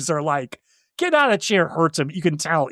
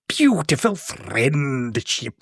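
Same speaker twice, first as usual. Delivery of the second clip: villain line; 3 semitones lower, much slower than usual. Retold or imitated. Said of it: "to say something like".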